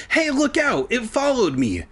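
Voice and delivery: high shouted warning; alarmed yell; urgent warning. A narrator's reading. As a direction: call out to warn her. Warning friend of chasing crab.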